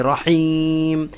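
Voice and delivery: রহিম with a lengthened vowel; slow, held রহিম; elongated vowel. Shown as a demonstration. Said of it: "আবার এখানে টান হবে".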